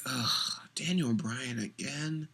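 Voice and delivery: fed-up groan. Retold or imitated. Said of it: "you're like".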